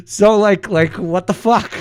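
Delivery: laugh, then a loud, high what the fuck; cracking up in disbelief; amused outrage breaking through laughter. Real, not acted.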